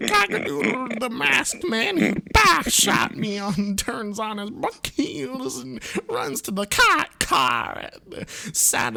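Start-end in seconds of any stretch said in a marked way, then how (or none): none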